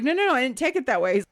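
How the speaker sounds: imitating an agent's quick denial; hurried reassurance. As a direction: rush to wave the worry away. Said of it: "He was like".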